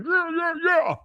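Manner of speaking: very high hollered cheer; mock megaphone cheering. Imitating old cheerleaders out there, mock megaphone yell. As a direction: yell it as if through a cone. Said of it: "they had that giant cone or whatever".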